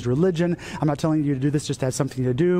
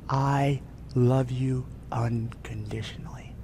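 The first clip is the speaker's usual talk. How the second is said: slow, soft, low whisper; tender whisper; 3 semitones lower, 5 dB quieter, slower than usual. Acted. Jesus speaks love into the believer's ear, tender whisper. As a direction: whisper it slowly, word by word. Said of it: "he whispers into your ear".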